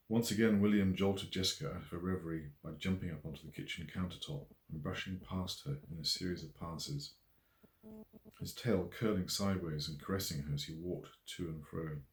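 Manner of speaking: plain reading voice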